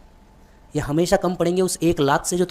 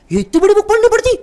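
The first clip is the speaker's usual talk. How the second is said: amazed dad; 7 dB louder than usual. Retold or imitated. Dad amazed at tax book.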